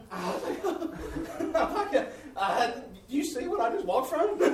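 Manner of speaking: disbelieving laughter; laughing. Real, not acted. Told to preach right after the climb, laughing hard.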